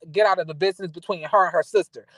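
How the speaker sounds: usual speaking voice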